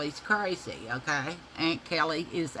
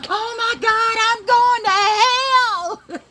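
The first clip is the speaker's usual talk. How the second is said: high, slow, sing-song wail of dismay; wailing panic; 11 dB louder, slower than usual. Retold or imitated. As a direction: wail it like a mock lament. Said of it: "she goes".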